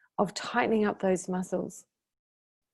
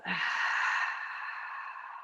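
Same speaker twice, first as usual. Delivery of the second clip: long, airy breath out; demonstrated cleansing exhale; long exhaling sigh; 2 semitones lower, 7 dB quieter than usual. Shown as a demonstration. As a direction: sigh the whole breath out slowly. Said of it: "really exhale that breath out".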